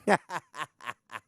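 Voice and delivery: short staccato laugh. Real, not acted. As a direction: laugh in a quick run of ha-has. Laughs after loving Deion's passion.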